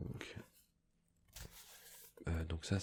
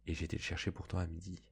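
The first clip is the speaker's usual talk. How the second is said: ordinary delivery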